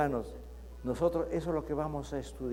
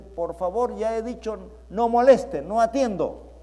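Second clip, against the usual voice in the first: annoyed shout; 5 semitones higher, 6 dB louder than usual. Retold or imitated. Shopkeeper yelling at someone knocking, annoyed shout. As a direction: shut the caller out loudly. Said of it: "Con mayor desesperación toca la puerta y dice".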